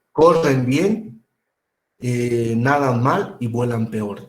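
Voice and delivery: drawn-out, deliberate aphorism; slow punchline. Retold or imitated. Teacher quoting a textbook aphorism, slow and deliberate. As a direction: deliver the saying slowly for effect.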